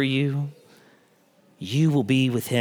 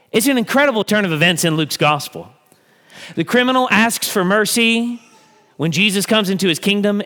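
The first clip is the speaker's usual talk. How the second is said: ordinary talk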